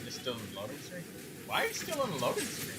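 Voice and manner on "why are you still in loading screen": higher, disbelieving question; can't believe it, pitch jumps up; incredulous. Real, not acted. Friend stuck loading, asked twice.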